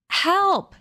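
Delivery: high, loud scream for help; boy pulled off his feet, screaming. A narrator's reading. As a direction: scream it as he's grabbed. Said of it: "he screamed".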